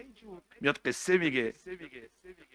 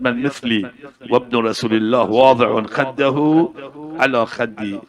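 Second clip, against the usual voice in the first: loud cry of joy from the ground; 8 dB louder than usual. Retold or imitated. Dying servant cries out on seeing Hussein, loud. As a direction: call it out loud, overwhelmed. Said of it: "فریاد زد".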